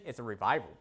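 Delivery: usual speaking voice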